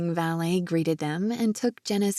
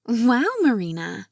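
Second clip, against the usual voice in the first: higher, louder admiring wow; be wowed by your friend; impressed approval; 4 semitones higher, 5 dB louder than usual. A narrator's reading. Jenna admiring Marina's new dress, impressed. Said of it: "with a low whistle of approval".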